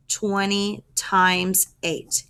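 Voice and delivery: slow, deliberate "Twenty times eight"; teacher slowing down to drive "Twenty times eight" home; slow emphatic repeat. Shown as a demonstration. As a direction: spell "Twenty times eight" out slowly for the class.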